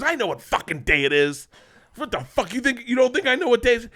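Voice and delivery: angry dad. Retold or imitated. Dad yelling at his son on his birthday. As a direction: rant it like an outraged father.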